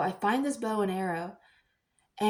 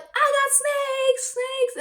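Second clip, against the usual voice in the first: high, slow, drawn-out singsong; drawn-out high singsong about the snakes; singsong tease; 4 dB louder, much slower than usual. Retold or imitated. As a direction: stretch it out high to tease the kids. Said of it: "I'm like".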